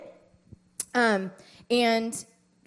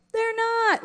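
mild mock kid answer; 14 semitones higher, 3 dB louder than usual. Kids answering.

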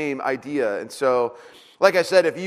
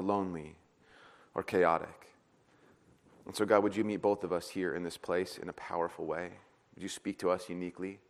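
soft prayer; 8 semitones lower, 6 dB quieter than usual. Real, not acted. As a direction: pray softly and reverently.

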